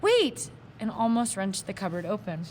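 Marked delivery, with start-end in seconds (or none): 0.0-0.5 sudden high cry. Acted. Boy cries out leaping from bed, high urgent cry. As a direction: yelp it out eagerly. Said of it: "He cried".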